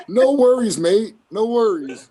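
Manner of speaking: high, loud mock Aussie; Australian accent. Trying Australian for friends, high put-on Australian accent. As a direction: sing out a breezy Australian accent. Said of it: "do an Australian accent".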